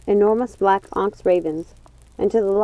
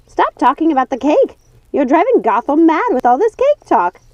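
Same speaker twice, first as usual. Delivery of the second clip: high, louder scolding witch voice; 8 semitones higher, 6 dB louder than usual. Acted. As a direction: shush her silly sisters. Lucinda scolds sisters.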